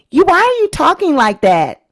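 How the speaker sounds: mildly imitating a child calling out her mother; challenging daughter. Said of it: "We've seen North say to her mother".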